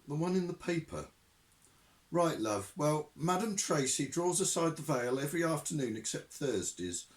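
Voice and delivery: a hint of a chatty landlady on the phone; breezy, friendly phone voice, calling him love; landlady answering the advert line, breezy and friendly; Madam Tracy.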